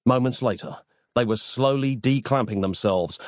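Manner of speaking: plain reading voice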